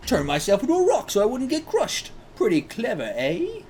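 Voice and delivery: high-pitched, cheeky character voice; put-on voice, pitched well up. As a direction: play a character pleased with their own trick. Voices the manga character's line.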